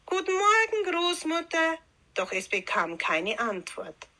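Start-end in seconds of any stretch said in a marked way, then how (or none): 0.1-1.8 put-on very high, ringing child's greeting; calling out. A narrator's reading. Rotkäppchen calling to Grandmother from the doorway, calling out. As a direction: call into the house in a high voice. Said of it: "Laut rief Rotkäppchen".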